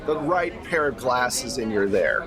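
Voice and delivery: ordinary delivery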